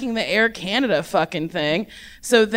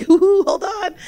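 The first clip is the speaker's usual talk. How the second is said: gleeful; 8 semitones higher, 4 dB louder than usual. Retold or imitated. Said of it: "gleefully was like".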